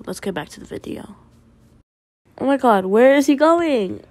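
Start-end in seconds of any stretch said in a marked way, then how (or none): 2.4-4.1 high, loud, quick oh my god; startled exclaim. Real, not acted. Gamer yelling at a runaway character, loud. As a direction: blurt it in shock.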